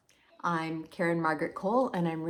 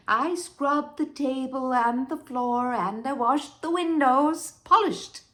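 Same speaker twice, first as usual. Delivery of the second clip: rising higher through a drawn-out list; frenzied insistence; 5 semitones higher, 4 dB louder than usual. Acted. Wife lists her obsessive cleaning to Henry, frenzied. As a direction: build each chore to a high, punched finish. Recounting frantic cleaning, building.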